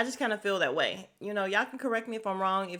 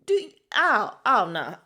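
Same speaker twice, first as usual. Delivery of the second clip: louder, drawn-out outraged refusal; exaggerated appalled outburst; loud hell no; 2 semitones higher, 9 dB louder, slower than usual. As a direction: recoil loudly at the screaming. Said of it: "And I'm like".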